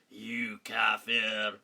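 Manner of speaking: low, slow, drawn-out accusation; mock hate message. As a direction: put on a ponderous accuser's voice. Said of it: "You know, you read the normal".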